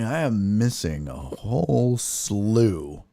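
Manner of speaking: normal talking voice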